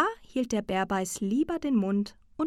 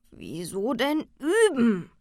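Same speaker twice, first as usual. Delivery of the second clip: slow, mildly grumpy bear; dumpfer Bärbeiß; 4 semitones higher, 4 dB louder, slower than usual. A narrator's reading. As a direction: drag out the reluctant question. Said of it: "sagte der Bärbeiß dumpf". (langsam, leicht brummig gedehnt)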